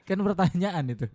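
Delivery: bursts into a laugh, then talks through it; real laugh spilling into the words. Real, not acted. Laughing at his co-host's question.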